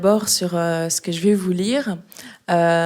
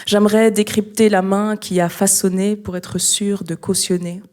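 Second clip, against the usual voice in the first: louder, measured recital with pauses between phrases; slam poetry reading; 6 dB louder than usual. Acted. Slam poet reading a museum text to an audience, poetry recital. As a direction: declaim each phrase, leaving space after it.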